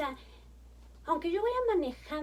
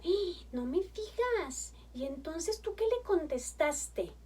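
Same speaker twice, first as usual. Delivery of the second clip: higher and slow, wide-eyed curiosity; eager interest; 5 semitones higher, slower than usual. Shown as a demonstration. Mother in the car to her little daughter, eager and amazed. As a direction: draw the child out with delighted surprise. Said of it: "estoy haciendo el tono como para que ustedes puedan ver".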